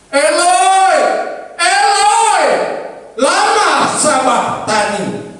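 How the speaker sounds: high, loud anguished cry to God. Acted. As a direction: cry out as if on the cross. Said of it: "berseru dengan suara nyaring". (seruan keras dan tinggi penuh derita)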